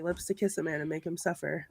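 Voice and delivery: soft quick murmur; quiet, fast repeat. Real, not acted. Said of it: "I want that tattooed on me".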